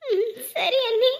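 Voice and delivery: slow, sobbing assent; choked reply trailing into sobs; crying. Acted. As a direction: agree through lingering tears. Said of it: "அழுகாத முதல்ல".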